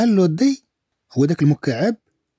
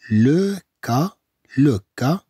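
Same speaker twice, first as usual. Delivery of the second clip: "لو كا" drawn out slowly; slow sounding-out for learners; slow syllables; slower than usual. Shown as a demonstration. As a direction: stretch and separate "لو" and "كا".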